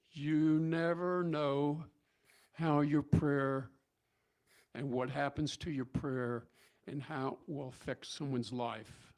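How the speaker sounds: very slow, drawn-out words with weighty pauses; solemn moral. Real, not acted. Preacher giving congregation the story's moral, slow and solemn. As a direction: let each phrase land slowly and deliberately.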